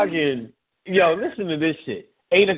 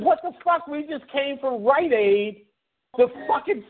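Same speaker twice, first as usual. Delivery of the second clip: mock indignant; 8 semitones higher than usual. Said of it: "I said".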